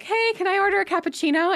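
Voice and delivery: high-pitched customer ordering; lightly mocked customer voice; drive-through customer. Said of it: "someone would come up to the drive and be like".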